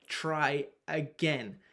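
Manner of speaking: drawn out, very slow, each word weighed; emphatic and slow. Shown as a demonstration. Coach stressing to keep trying, very slow. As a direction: lean on it slowly so it sinks in. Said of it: "I can't stress that enough".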